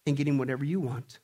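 loud, high, driving sermon delivery; full-force preaching. Acted. Pastor rousing the congregation against selfishness, loud preaching.

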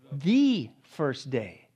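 "The first day" very slow, each word weighed; emphatic. Shown as a demonstration. Preacher stresses "The first day" very slowly to the congregation. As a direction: lean hard on "first day". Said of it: "Very emphatic".

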